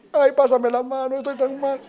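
very high, whiny fake weeping; mock crying. Preacher mimicking the scolder when it's his turn to cry, wailing. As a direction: wail pitifully in a put-on squeal. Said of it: "cuando te toca llorar".

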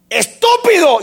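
mock yell of a rude son; child yelling insult. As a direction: shout it like a rude kid. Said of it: "si un hijo le dice a usted".

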